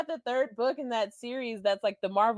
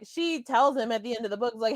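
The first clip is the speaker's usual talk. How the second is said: ordinary delivery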